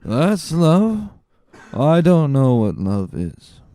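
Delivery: put-on a drunk jeering at lovesick talk; tipsy. A narrator's reading. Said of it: "with a voice more tipsy than ever".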